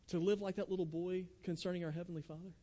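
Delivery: ordinary talk, nothing marked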